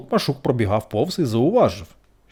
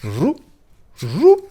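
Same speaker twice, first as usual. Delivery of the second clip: rising to a high loud buzz; surprised buzz; 7 semitones higher, 7 dB louder than usual. A narrator's reading. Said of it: "здивувався Жук". (здивоване жужжання)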